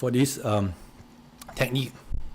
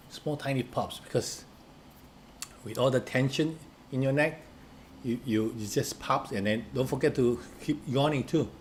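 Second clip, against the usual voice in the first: much softer, hushed coaching voice; hushed guided stretch; 6 dB quieter than usual. Acted. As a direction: talk them gently through each tiny pop. Guides the neck stretch softly.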